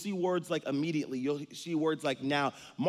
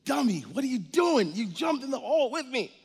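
higher, disbelieving dummy; incredulous scolding; 10 semitones higher, 4 dB louder than usual. Acted. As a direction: scold a friend who jumped in. Man scolding friend.

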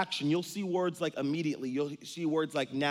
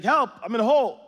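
much higher and louder cry for help; calling for help; 9 semitones higher, 10 dB louder, faster than usual. Acted. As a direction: yell up out of a hole. Man in hole yelling.

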